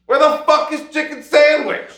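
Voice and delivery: high, loud outburst; loud, worked-up demand; exasperated shout. Real, not acted. Demands to know where he is.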